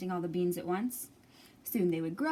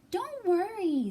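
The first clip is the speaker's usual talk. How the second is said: high, slow, sweet reassurance; soothing little girl; 10 semitones higher, 4 dB louder, slower than usual. Acted. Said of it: "said the girl".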